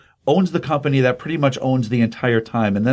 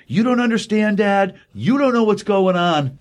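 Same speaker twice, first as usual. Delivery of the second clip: put-on much higher, a teenage rant at dad; higher mock-teen protest to his father; angry teen son; 8 semitones higher than usual. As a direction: play the sulky son talking back. Son yelling at father in quarrel.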